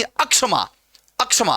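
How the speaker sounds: अक्षमा with a wrong vowel before ksha; extra initial a. Shown as a demonstration. Teacher shows another error to avoid, a-prefixed अक्षमा. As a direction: add an a to the front, अक्षमा. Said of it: "अक्षमा भी नहीं है".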